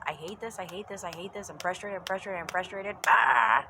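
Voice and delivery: run-on grumble bursting into a loud "ah"; frustrated spiral. Acted. Acting someone who only thinks negatively, frustrated outburst. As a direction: chant the complaint, then explode in a yell. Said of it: "If all you're thinking is".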